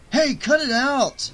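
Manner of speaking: high, loud shout. Acted. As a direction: call out sharply. Dead friend shouting from ladder.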